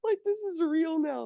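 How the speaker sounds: real tearful catch. Real, not acted. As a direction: let it catch in your throat as it sinks in. Overwhelmed recalling her conversion.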